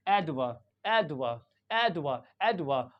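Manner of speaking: "एडवा" as AED-vuh, short second syllable; quick reduced 'vo'. Shown as a demonstration. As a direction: say "एडवा" low and quick, ending on a schwa. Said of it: "इसको भी जल्दी पढ़ते हैं".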